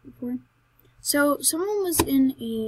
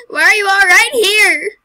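very loud, high-pitched shriek; shrill-pitched, full-volume outburst; startled yell; 10 semitones higher, 17 dB louder than usual. Real, not acted. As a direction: yell it high in exasperated alarm. Startled by mobs among flowers.

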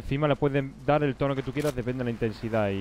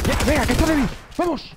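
high, loud excited urging; cheering a teammate on, pitch way up; 13 semitones higher, 4 dB louder than usual. Real, not acted. Gamer urging on his squad over an enemy, excited high cheer. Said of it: "que me emociono".

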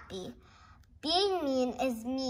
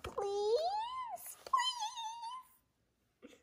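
crying plea; 9 dB quieter than usual. Acted. Begging the frog to return.

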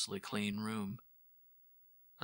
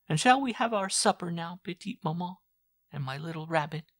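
put-on higher, lightly teasing girl's voice; playful young girl; 9 semitones higher, 8 dB louder than usual. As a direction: coax your mother sweetly to supper. Girl's voice in dialogue.

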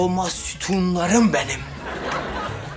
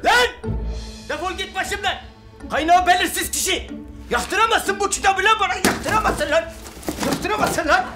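angry shouting; 10 semitones higher than usual. Acted. Shouting to keep the book.

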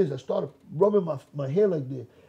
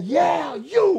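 high, drawn-out shout; single him out with a raised call; 10 semitones higher, 4 dB louder than usual. Retold or imitated. Preacher singles out the young man, calling out.

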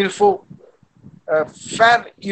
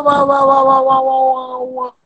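put-on baby fussing; 6 semitones higher, 4 dB louder than usual. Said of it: "comme un maman donne, euh, la nourriture à son bébé".

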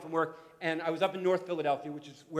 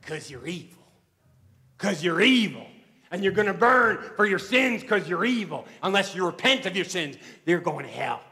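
imitating a street preacher's tirade; street preacher rant; 9 dB louder than usual. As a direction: rail at the whole train like a fire-and-brimstone preacher. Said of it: "She went on, she said".

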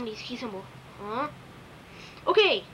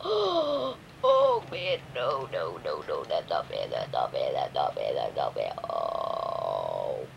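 deep growly crying monster voice, much lower and softer; whiny defeated monster; 3 dB quieter, slower than usual. Pretend. Beaten toy fighter whines at the unfair match, deep monster cry. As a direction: sob out the protest in a low growl.

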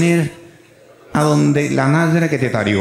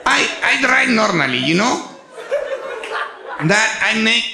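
higher, slow pleading broken English; 7 semitones higher than usual. Retold or imitated. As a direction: plead your case in clumsy English. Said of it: "ahí empieza un inglés raro".

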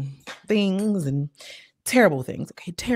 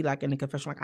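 ordinary delivery

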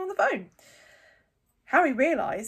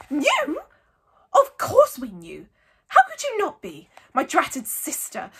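loud, high-pitched outburst; Aunt Petunia shrieking; 6 semitones higher, 8 dB louder than usual. A narrator's reading. Aunt venting about her sister, shrieking. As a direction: shriek with scorn. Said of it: "shrieked Aunt Petunia".

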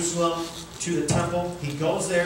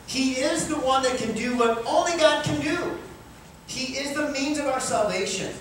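high, ringing preacher's build; preaching at full force; 7 semitones higher than usual. Acted. Pastor to congregation, preaching at full force. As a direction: lift the room toward the climax.

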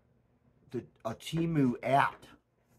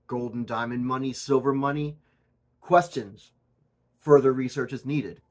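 ordinary talk

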